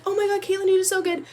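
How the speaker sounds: high, loud gushing mother; excited mom cheering her daughter; mom's voice. Retold or imitated. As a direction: play mom rushing in proud then worried. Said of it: "she was like".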